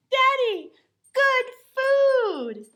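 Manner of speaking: put-on high, loud little girl voice; excited child. A narrator's reading. Little girl showing her father the ice cream, high and loud. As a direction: beam it out proudly.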